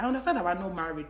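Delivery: usual speaking voice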